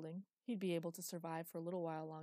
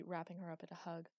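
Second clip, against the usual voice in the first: usual speaking voice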